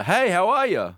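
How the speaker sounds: high, chirpy customer-service greeting; mock friendly waiter. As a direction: sing it out like a waiter who is far too eager. Mocking an overly friendly American waiter.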